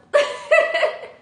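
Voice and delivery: bright burst of laughter; quick high laugh. Real, not acted. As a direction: laugh it off, amused. Laughs at own slip-up.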